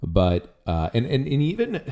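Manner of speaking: ordinary talk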